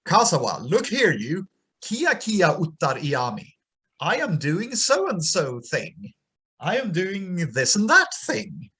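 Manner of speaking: voiced goddess. Goddess calling Hobasia to help with her feast. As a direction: call out to him as the goddess.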